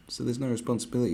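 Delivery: ordinary talk, nothing marked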